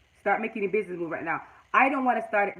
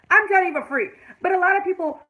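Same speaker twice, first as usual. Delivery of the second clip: much higher and louder, exasperated; 9 semitones higher, 7 dB louder than usual. Real, not acted. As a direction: raise your voice in disbelief. Exasperated rant about paid advice.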